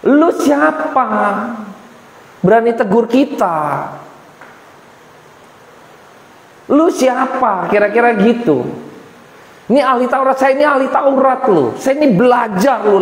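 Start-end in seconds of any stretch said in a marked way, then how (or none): 0.0-7.7 haughty, slow challenge; mock an arrogant scholar; slow scornful put-down; arrogant sneer. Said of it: "dia ini arogan". 9.7-13.0 arrogant boast. Retold or imitated. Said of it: "dia ini arogan".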